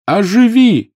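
high, loud desperate command; urgent yelled incantation; shouted spell. A narrator's reading. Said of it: "выкрикнул он".

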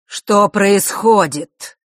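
громкий медленный властный вопрос; прогреми, как гром среди ясного неба; громовой голос. A narrator's reading. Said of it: "как гром среди ясного неба". (громкий властный голос)

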